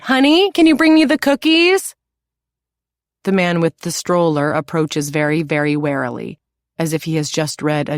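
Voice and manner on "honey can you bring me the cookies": very high, loud call across the platform. A narrator's reading. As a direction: call to your husband far off. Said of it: "calls out".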